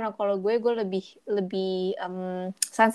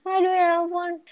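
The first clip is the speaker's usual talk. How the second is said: exasperated; 9 semitones higher than usual. Real, not acted. Exasperated sigh at the story.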